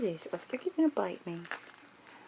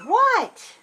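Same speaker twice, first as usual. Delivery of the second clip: high, loud cooing question; 13 dB louder than usual. Real, not acted. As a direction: squeal it to the puppy. Pet voice to the dog.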